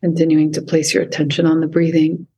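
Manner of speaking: calm, unhurried meditation voice with long pauses; guided meditation. Acted. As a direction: guide the listener gently into stillness. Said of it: "lead us through any sort of meditation".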